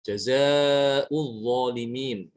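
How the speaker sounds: jazaa'ul zalimin drawn out slowly in Arabic recitation; slow Quranic recitation. Shown as a demonstration. Teacher recites the example for students, slow and measured.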